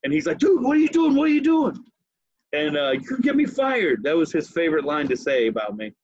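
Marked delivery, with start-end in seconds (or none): 0.4-1.8 high, loud panicked protest; mock-alarmed yelp of disbelief; panicked friend. Acts out paranoid friend caught drinking. 2.9-4.0 mock panic. Friend's worried favorite line, imitated.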